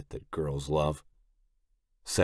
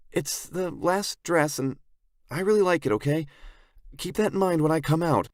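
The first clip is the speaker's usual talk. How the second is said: put-on much higher girlish voice, calling out; high-pitched plea from the dressing room; 12 semitones higher than usual. A narrator's reading. Sarah to the men from behind the curtain, girlish calling out.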